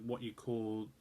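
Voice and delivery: ordinary delivery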